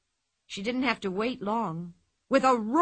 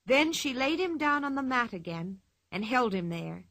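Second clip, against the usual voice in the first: ordinary delivery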